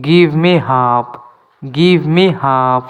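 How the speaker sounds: slow, clear English for learners; careful dictation pace; slow teaching demo. Shown as a demonstration. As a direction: spell each word out slowly for students. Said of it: "এখানেও এলের উচ্চারণ করা হয় না".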